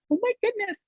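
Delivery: high, delighted gasp of surprise; shocked and surprised. Retold or imitated. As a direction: let it jump up in happy shock. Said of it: "shocked and surprised".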